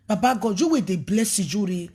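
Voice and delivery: ordinary delivery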